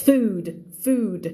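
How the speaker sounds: FOOD with a long vowel and a D ending; final D. Shown as a demonstration. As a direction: stretch FOOD and close it on D. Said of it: "เสียงจบคือดีนะคะ".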